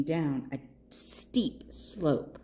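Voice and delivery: slow, soft, whispery trailing down; hushed suspense. A narrator's reading.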